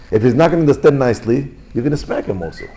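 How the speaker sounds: normal talking voice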